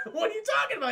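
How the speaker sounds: high, incredulous squeal with a laugh; acted shock breaking into laughter; laughing disbelief. Goliath questions Broadway's confession, laughing disbelief.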